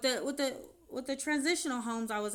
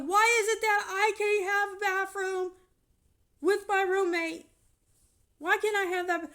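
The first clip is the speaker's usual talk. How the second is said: high, loud pleading shout; 10 semitones higher, 7 dB louder than usual. Retold or imitated. Shouting at the manager, high and loud. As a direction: demand it through the tears. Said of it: "and I'm shouting".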